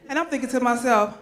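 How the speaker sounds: usual speaking voice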